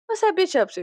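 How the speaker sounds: high, gleeful gossip; nosy fan voice. Retold or imitated. Checking on favorite YouTubers, high gleeful gossip. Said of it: "I'm like".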